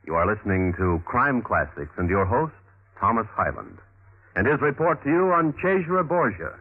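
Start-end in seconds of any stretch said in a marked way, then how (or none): none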